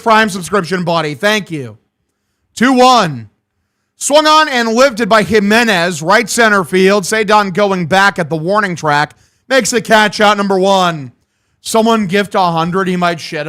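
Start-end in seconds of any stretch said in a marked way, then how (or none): 2.5-11.1 a lightly voiced radio baseball call; a hint of a ballpark announcer; play-by-play call. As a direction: call the pitches like a broadcaster. Calls the next at-bat.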